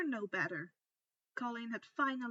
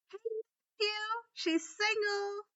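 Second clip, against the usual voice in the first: calling out; 10 semitones higher, 5 dB louder than usual. A narrator's reading. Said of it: "Luna called out".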